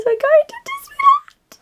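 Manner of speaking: squealed way up high; a giddy high squeal about the trip; excited squeal. Real, not acted. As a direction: shriek it with pure delight. Excited squeal announcing Disneyland.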